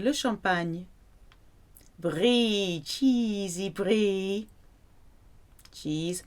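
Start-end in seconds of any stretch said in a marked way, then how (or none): 2.0-4.5 slow, savoring cheesy brie. Pretend. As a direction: relish the word cheesy slowly.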